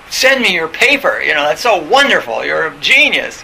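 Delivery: loud, fawning mock admirer; gushing praise. Admirers flattering the speaker in a fantasy, gushing praise. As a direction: gush it out like a dazzled fan. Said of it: "everybody's gonna clap and go".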